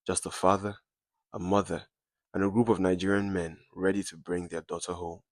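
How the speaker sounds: ordinary delivery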